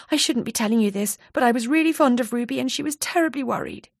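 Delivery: lightly voiced anxious friend. Donna looking round nervously.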